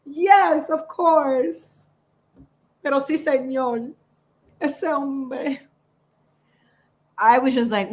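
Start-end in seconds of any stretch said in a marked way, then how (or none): 0.0-5.6 high, loud opening, softening into drawn-out agreement; acted fan cheering. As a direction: egg the singer on like delighted fans. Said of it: "And all of us are like".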